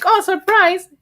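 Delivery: mock bright announcement; mock cheery surprise. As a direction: chirp it like a fake cheerful message. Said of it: "they were like".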